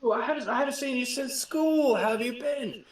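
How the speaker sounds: high, eager British greeting; jittery British voice. Acted. Marwyn greets the boarding captain as an old schoolmate, jittery British voice. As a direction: play the nervous Brit bluffing a friendly hello. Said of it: "am pretty much like".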